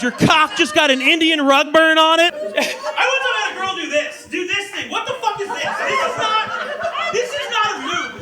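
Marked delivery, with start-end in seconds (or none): 4.9-8.2 stretched, high, laughing "this is not"; baffled disbelief. Acted. To the girl, incredulous at her strange move.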